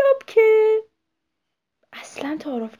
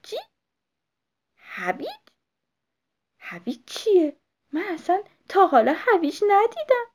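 surprised; 10 semitones higher, 6 dB louder than usual. Acted. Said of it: "پلاپ تعجب کرد".

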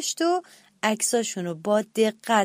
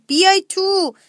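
high, loud call from inside the pumpkin; greedy brother calls the others into the pumpkin, loud and high; shouted call; 8 semitones higher, 7 dB louder, slower than usual. A narrator's reading. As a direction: holler it cheerfully so they hear. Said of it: "داد زد".

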